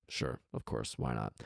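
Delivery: sly whispered genie reply. Acted. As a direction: breathe it out, no voice at all, offhand and sly. Genie voiced in read story.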